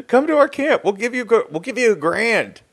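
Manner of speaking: high, eager huckster's call; mock recruiting pitch. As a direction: call it out like a comic hawker.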